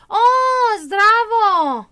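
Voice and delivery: high, loud, delighted hello in Serbian; excited greeting. Real, not acted. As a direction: burst out with a bright zdravo.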